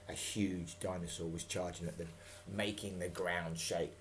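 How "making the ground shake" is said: ordinary delivery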